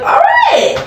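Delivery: squeaky reply. Retold or imitated. Acted reply, much higher.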